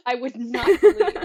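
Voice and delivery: laughing in delighted disbelief. Real, not acted. Telling cohosts about her childhood favorite, laughing.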